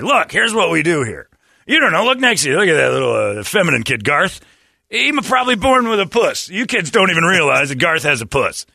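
put-on a crude coach lecturing kids; ranting coach. Mock coach lecturing his team.

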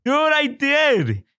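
high, slow, excited; drawn-out excited. Real, not acted. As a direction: stretch it out gleefully. Excited about new cat.

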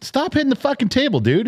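high-pitched irritated reprimand; exasperated scolding. Real, not acted. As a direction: scold someone for an annoying habit. Scolds guest for hitting the table.

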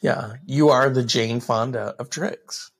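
low far podcast host voice; deep announcer voice. Pretend.

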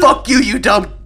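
imitating a hot-headed reply, loud and high; mock aggressive yell. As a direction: mimic a friend going off at someone. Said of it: "But you were like".

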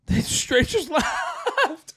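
breaks into laughter, high and slow; laughing. Real, not acted. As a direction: laugh the words out in disbelief. Laughing while telling friends left.

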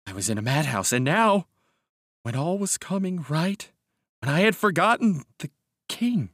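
high, desperate begging that swells in bursts; anguished pleading. A narrator's reading. Broken man begs Mister Castaigne for help, anguished pleading. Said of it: "crying and gasping".

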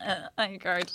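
very soft, low stammer; imitating a tongue-tied, stopped driver; mock nervous fluster. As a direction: shrink away as you say it. Acting out instant nerves when stopped.

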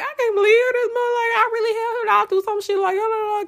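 very high, fast mock rant; frantic retold rant. Venting at her ex in distress, high and fast. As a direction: spill it all out in a rush.

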